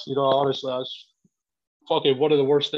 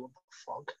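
muttered to himself; 4 semitones lower, 18 dB quieter than usual. Retold or imitated. Sat there thinking in disbelief.

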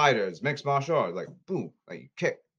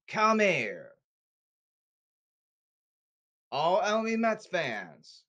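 high, insistent call-out; high-pitched, worked-up demand; emotional summons; 5 semitones higher than usual. Real, not acted. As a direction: call the culprits over in a raised pitch. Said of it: "I'm gonna get very emotional here".

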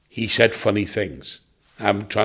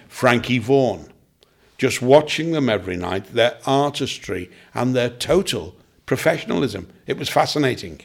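ordinary talk, nothing marked

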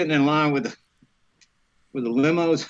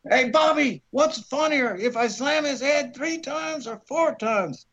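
high-pitched shout over to the director; retold call across the room; 9 semitones higher than usual. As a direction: pitch it up to carry across the set. Calls to director mid-scene.

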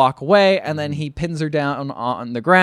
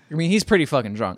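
usual speaking voice

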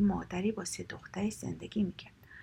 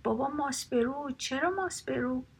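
high, mildly raised storybook cry; narrated shout, higher but only slightly louder; calling out; 5 semitones higher, 3 dB louder than usual. A narrator's reading. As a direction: call out in astonishment, pitched up. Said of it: "پیرزن فریاد زد".

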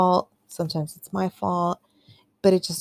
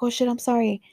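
higher-pitched quoted oops; retold startled apology; 7 semitones higher, faster than usual. Retold apology after nicking him.

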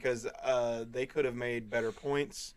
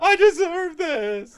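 mock scream; 13 dB louder, slower than usual. Quoting movie character's scream.

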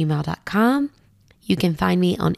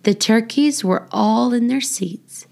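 lively, sing-song picture-book reading; reading to children; 3 semitones higher than usual. Acted. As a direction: read it like a bedtime rhyme for little kids. Reads children's book aloud.

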